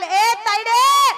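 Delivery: drawn-out call to the granddaughter; grandparent calling out. Pretend. (नातीला लांबवून हाक मारणारी आजी)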